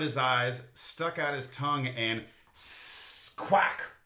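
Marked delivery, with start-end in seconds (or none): 1.9-3.3 a duck starting to hiss; attempted hiss. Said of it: "Duck gave it a try". 3.4-3.9 unvoiced, breathy quack; whispered quack. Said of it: "Duck gave it a try".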